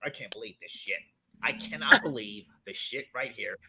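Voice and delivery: low disgusted mutter of a beaten quarterback; pissed-off Baker Mayfield. Retold or imitated. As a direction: grumble it under your breath, fuming. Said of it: "He was so pissed off".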